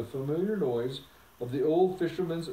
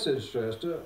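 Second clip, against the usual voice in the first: usual speaking voice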